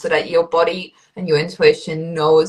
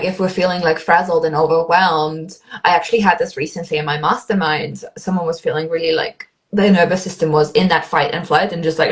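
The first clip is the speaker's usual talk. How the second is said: usual speaking voice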